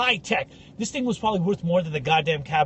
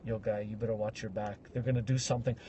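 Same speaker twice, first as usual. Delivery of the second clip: much lower, much softer ominous warning; 8 semitones lower, 10 dB quieter, faster than usual. Retold or imitated. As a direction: hush it like a secret threat. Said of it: "he's like".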